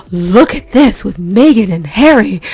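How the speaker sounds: breathless gasp, then an eager gossipy line; gossip excitement. Retold or imitated. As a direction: share juicy news with your sister. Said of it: "I called up my sister and I was like".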